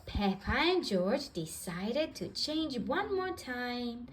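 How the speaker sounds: ordinary delivery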